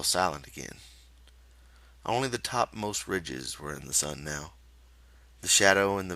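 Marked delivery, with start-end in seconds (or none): none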